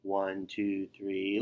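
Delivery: ordinary delivery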